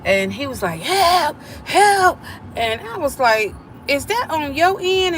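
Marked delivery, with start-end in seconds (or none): 0.8-2.2 high, loud mock cry for help; retold yelling for help. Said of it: "I hear somebody yelling". 3.9-5.2 high, alarmed question; startled. Retold or imitated. Startled question to niece on phone.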